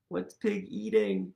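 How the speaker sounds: usual speaking voice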